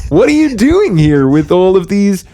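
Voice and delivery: put-on cheery kids' show bear voice; friendly bear host. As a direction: welcome the kids into the big blue house. Bear sketch continues.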